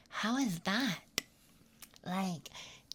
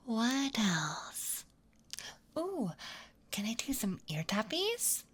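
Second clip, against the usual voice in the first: whispering; 4 semitones higher than usual. Acted. ASMR whisper.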